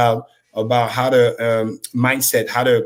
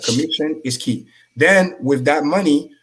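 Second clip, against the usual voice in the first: normal talking voice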